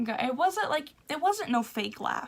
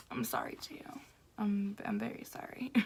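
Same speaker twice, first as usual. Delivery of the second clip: much softer, whispered apology; sheepish whisper; 2 semitones lower, 11 dB quieter than usual. Retold or imitated. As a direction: apologise under your breath, embarrassed. Embarrassed apology for misquoting.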